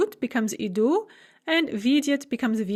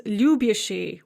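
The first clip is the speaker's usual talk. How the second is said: любящий with the accent on лю; stress on Ю. Shown as a demonstration. Teacher shows the big exception любящий, stress on Ю. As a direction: stress the first syllable of любящий. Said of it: "with the stress on the U".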